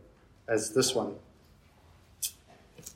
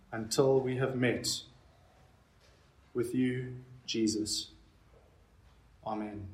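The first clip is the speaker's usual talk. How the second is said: earnest pleading prayer; 5 dB quieter than usual. Acted. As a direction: plead quietly with God. Leads the prayer.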